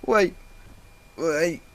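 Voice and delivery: high, loud, slow plea; pleading. A narrator's reading. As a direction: beg him to stay. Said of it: "she pleaded".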